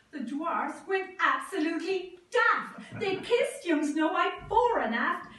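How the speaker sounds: ordinary delivery, nothing marked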